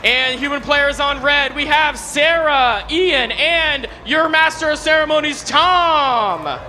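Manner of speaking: drawn-out hype arena introductions; fighting game announcer. Acted. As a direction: stretch each name like a ring announcer. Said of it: "I'm your guest game announcer".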